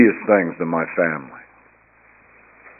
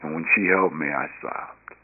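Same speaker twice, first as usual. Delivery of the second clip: choked up; 4 semitones lower, 8 dB quieter than usual. Real, not acted. Tears while recalling grandmother's embrace.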